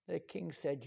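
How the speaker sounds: ordinary delivery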